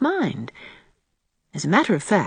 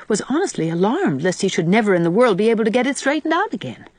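plain reading voice

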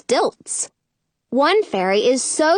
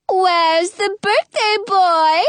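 slow fairy voice; 8 semitones higher, slower than usual. Acted. Fairy Michelle asks.

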